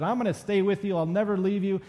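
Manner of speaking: emphatic vow. Retold or imitated. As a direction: pledge it firmly, lightly acting the disciple. Voicing Peter's vow to stay.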